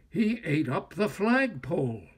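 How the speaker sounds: plain reading voice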